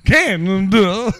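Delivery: slow, loud, laughing twang on "Canada"; country Canada. Shown as a demonstration. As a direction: stretch "Canada" out like a drunk cowboy, laughing. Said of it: "how would you say Canada in a country way?".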